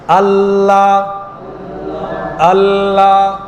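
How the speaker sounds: আল্লাহ intoned slowly, lingering on the double L; held L sound. Shown as a demonstration. Preacher leads congregation in pronouncing, held L in আল্লাহ. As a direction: stretch the L and final vowel of আল্লাহ on one note. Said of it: "মুখের তালু এখানে লাগছে".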